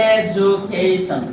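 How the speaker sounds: Education drawn out slowly syllable by syllable, ending in a falling tune; slow model word. Shown as a demonstration. Teacher modelling education for pupils, slow and clear. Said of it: "যেমন এখানে".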